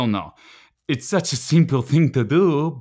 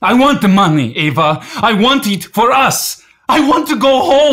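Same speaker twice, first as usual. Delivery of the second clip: rising higher and louder with each line; building desperate plea; 7 semitones higher, 4 dB louder than usual. Acted. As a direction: push harder and higher until it breaks into a cry. Husband pleads during heated quarrel.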